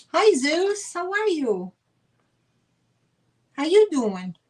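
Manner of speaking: high, bright hello to a viewer; excited greeting. Real, not acted. Seller greeting a regular viewer, excited high hello.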